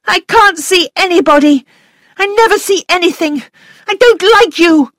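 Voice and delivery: put-on high, loud childish defiance; spiteful little girl. A narrator's reading. Flora rejecting the governess, high, loud and spiteful. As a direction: spit it at her like a bratty child. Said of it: "Her face was suddenly ugly".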